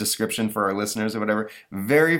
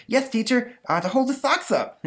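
put-on very high, eager kid voice; eager boy Stanley. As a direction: answer like a proud little schoolboy. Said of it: "says Stanley".

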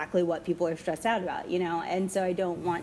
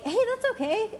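reassuring herself; faster than usual. Retold or imitated. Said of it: "I catch myself saying out loud".

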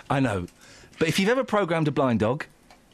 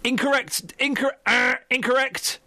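mock correction; 7 semitones higher than usual. Mock quizmaster correcting a caller.

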